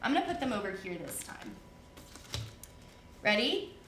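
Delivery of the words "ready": ordinary talk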